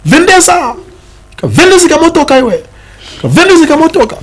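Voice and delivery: high-pitched mock yelling at a driver; retold cadres' shouting. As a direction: shout it in bursts like a mob ordering a car about. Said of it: "started shouting".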